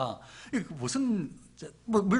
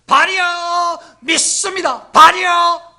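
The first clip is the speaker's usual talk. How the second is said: loud, high, drawn-out cries to a god; imitating frenzied idol worshippers calling out; fervent mock prayer; 12 semitones higher, 16 dB louder, slower than usual. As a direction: wail out each invocation at full volume. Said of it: "뭐 이렇게 막 기도했을 거예요".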